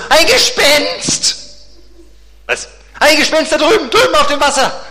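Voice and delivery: high, loud frightened yelling; terrified cry. Acted. Disciple yells to Peter about a ghost, high frightened cry. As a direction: scream it, seeing a ghost.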